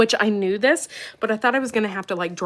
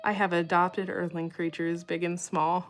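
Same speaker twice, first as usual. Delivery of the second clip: ordinary talk, nothing marked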